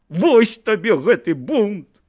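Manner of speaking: slow, high strained reproach; voice breaking. A narrator's reading. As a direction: push it out through a cracking voice. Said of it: "голас ягоны перасекся".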